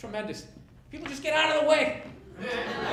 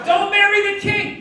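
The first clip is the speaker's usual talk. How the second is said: shouted warning, much higher and louder; comic shout; 9 semitones higher, 9 dB louder than usual. Acted. Comic to the audience, a loud shouted warning. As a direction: yell the advice at the crowd.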